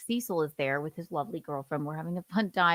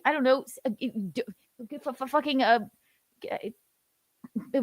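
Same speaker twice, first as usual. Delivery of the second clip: usual speaking voice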